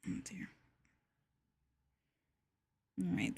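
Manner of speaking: ordinary talk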